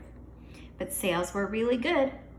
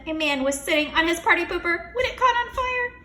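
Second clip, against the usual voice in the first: laughing panic; 9 semitones higher, 7 dB louder than usual. Acted. Klutz reporting fire, laugh cry.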